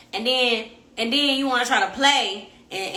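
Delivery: normal talking voice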